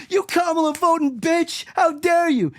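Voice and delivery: high, mocking accusation; hammed-up scolding at a girlfriend; mock outrage. To his girlfriend over her vote, high mock outrage.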